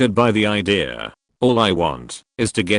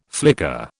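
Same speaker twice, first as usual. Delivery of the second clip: low flicker; 6 semitones lower than usual. Acted. Narrating the trip loop, a low dropped flicker. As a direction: say it low like a blink of lost time. Repeated refrain.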